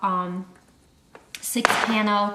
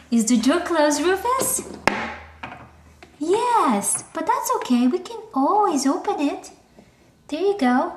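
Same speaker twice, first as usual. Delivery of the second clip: high, sing-song talk to a puppy; 7 semitones higher, 4 dB louder than usual. Real, not acted. Reassuring the puppy in his crate, high baby talk.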